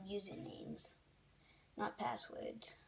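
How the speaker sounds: slow whisper to self. Real, not acted. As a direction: murmur it slowly under the breath. Muttering while checking a form.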